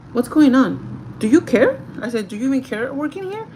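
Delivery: confrontational. Retold or imitated. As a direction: confront a slacking employee, retold with force. Retold confrontation with the worker.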